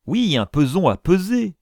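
high, exasperated reply; impatient retort. A narrator's reading. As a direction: answer out of all patience. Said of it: "commençaient à impatienter outre mesure". (réplique impatiente)

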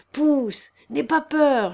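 loud, high call to push; urging on. A narrator's reading. Peasant urging a workman to push, urging on.

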